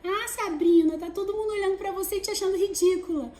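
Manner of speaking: high, fast, mocking little voice; nagging inner voice. Inner critic taunting the speaker, high and mocking. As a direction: needle yourself in a whiny high voice. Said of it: "vem aquela vozinha".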